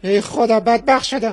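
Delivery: comic cry of despair; mock lament. Husband hears father-in-law died.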